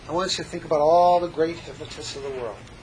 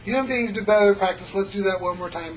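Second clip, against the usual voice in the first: hypnotic script; 4 semitones higher, 5 dB louder than usual. Acted.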